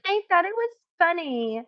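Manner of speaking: high mocking mimic of Glammy; high, mocking imitation. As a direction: squeak it out like a smug brat. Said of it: "And she's like".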